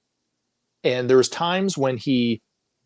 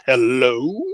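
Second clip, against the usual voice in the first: pointed hello; 5 dB louder than usual. Retold or imitated. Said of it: "She goes".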